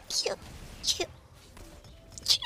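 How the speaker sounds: tiny stifled sneezes; quick little sneezes; sneezing. Real, not acted.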